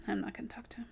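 whispered under the breath; whispered aside. Retold or imitated. Coworker whispering the real plan to a friend. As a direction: drop to a secret whisper. Private aside after fake agreement.

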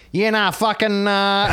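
high-pitched broad Australian accent, played up; bait-and-switch accent. Host re-enacts his bait-and-switch gag, high hammed-up Australian accent. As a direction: promise an accent, swerve into exaggerated Australian. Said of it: "this is a Nigerian guy, so I'll do the accent".

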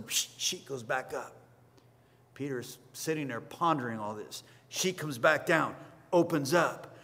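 normal talking voice

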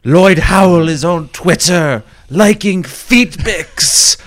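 put-on high, slow accent parody with a laugh; a foreign accent that slips into Indian; botched accent drifting Indian. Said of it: "I'm kinda going Indian with this".

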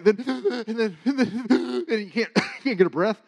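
high, gasping sobbed stammer; imitating hiccupy crying speech; crying, breathless. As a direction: choke out words between mock sobs. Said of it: "have you ever been crying".

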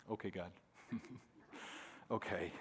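very low and soft, giving in; small, resigned concession; sheepish surrender. Retold or imitated. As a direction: mumble a chastened agreement. Sheepishly gives in to God.

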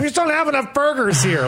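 high-pitched mock grumbling; mock complainer. Local mimicking a burger lover complaining about Clarksville, mock complaint.

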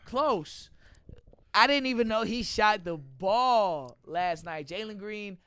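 normal talking voice

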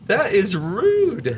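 slow, drawn-out offended; mock indignant. Real, not acted. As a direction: play it scandalized. Indignant at phone.

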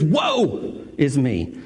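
very slow, stretched woe is me; drawn-out lament. Retold or imitated. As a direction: let the woe linger, slowly.

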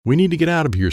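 high-pitched, restrained yell; urgent yell. A narrator's reading. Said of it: "Sensei yelled".

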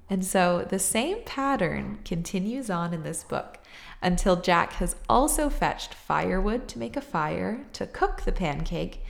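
usual speaking voice

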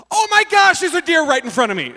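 high, loud shock, mid-daydream; sudden startled outburst. Retold or imitated. Zoned-out driver suddenly spots a deer, startled outburst. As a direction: burst out in sudden alarm. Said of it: "literally like that".

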